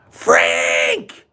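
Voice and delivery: mother yelling a name through the house; calling out. Retold or imitated. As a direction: call out sharply for your son. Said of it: "She called his name".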